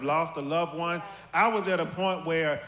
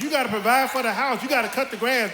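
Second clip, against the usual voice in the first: raised, insistent motherly lecture; mother's lecture; 6 semitones higher, 3 dB louder than usual. Retold or imitated. Mom telling her son to provide, insistent. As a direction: lay down the law like a mom.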